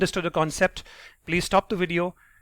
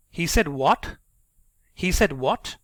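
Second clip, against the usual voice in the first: "what" swooping up as a question; incredulous-question rise on "what"; tone rising on what; 4 dB louder, slower than usual. Shown as a demonstration. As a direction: lift the pitch sharply on "what". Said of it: "my tone is going up on what".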